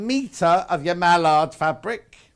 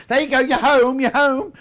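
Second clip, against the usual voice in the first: put-on higher, louder sing-song coo, as if settling a baby animal in; cooing to a toy; 8 semitones higher, 7 dB louder than usual. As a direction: coo it into its new home, high and bright. Settling toy fawn into hedgehog house.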